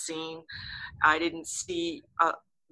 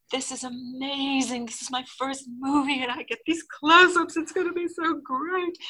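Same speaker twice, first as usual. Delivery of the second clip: high-pitched, thrilled inner voice, climbing higher; gushing excitement; 8 semitones higher than usual. Retold or imitated. Young actress thinking about her first movie close-ups, excited. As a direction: replay a newcomer's giddy delight.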